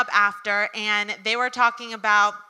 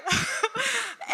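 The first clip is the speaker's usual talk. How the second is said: trailing laugh; 11 semitones higher, 5 dB quieter than usual. Real, not acted. Laughter trailing after fright.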